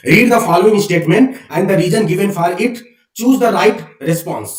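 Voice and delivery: deliberate question read-out; slow dictation. Shown as a demonstration. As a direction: dictate it so they can write. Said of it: "reading exam question aloud".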